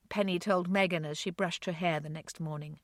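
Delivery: ordinary delivery, nothing marked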